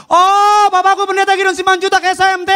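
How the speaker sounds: put-on very high, loud child's scream; mock frightened yell. Scared child calling papa by full title, comic yelling. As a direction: shriek out the whole formal title in one run. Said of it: "dia nggak pernah teriak".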